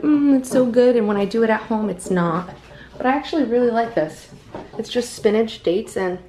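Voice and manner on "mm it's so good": imitating food influencers. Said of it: "and they're like".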